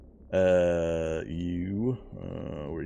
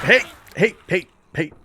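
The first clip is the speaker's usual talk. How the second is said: loud call; 11 semitones higher, 9 dB louder than usual. Real, not acted. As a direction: shout hey to get attention. Calling out to a character.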